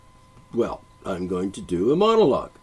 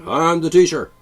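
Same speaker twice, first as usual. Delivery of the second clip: loud, raised self-important teacher; mock teacher; 6 semitones higher, 8 dB louder than usual. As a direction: announce yourself as the overbearing teacher. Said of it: "there's a teacher comes in".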